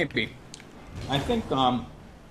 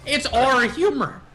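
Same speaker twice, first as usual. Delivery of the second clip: mock grandiose; 7 semitones higher, slower than usual. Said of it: "mock-grand description of own humor".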